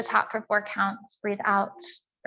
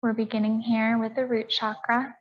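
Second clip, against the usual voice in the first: calm, measured yoga teacher's voice with long pauses; guided meditation. Real, not acted. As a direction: count the breaths evenly, leaving space between phrases. Leads breathing and meditation.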